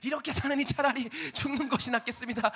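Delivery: loud, high cry of despair; anguished lament. Retold or imitated. Jonah crying out he would rather die, loud and high. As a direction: wail it like you'd rather die.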